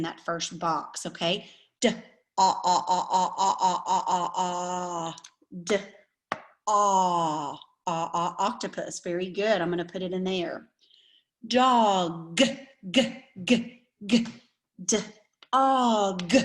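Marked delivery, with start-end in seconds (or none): 1.8-5.1 each sound stretched and said apart: "D", then "ah, ah, ah"; slow sound pushing. Shown as a demonstration. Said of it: "saying those words very slowly". 5.6-9.0 "D" then a drawn-out "ah, ah, ah" for the short o; slow sound pushing. Shown as a demonstration. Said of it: "saying those words very slowly". 11.5-16.3 "g, g, g" repeated, then "d" and "og" split apart; slow sound pushing. Shown as a demonstration. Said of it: "saying those words very slowly".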